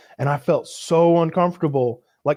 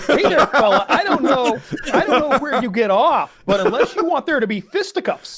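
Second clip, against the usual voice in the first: put-on old-timey tough guy; 9 semitones higher than usual. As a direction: play a comic old-fashioned bruiser picking a fight.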